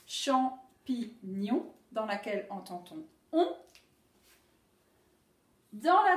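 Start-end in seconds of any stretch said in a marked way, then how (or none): 0.1-1.7 slow, drawn-out Champignon; syllable by syllable. Shown as a demonstration. Teacher to pupils, slow syllable count. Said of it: "trois syllabes".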